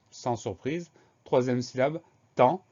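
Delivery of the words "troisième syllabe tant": ordinary delivery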